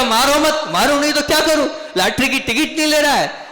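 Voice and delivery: high-pitched mock squabble; lightly acted exasperation, pitched high; mock protest. Acted quarrel over the ticket.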